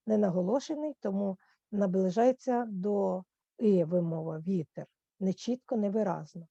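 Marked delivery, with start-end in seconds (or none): none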